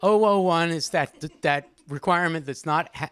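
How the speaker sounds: ordinary talk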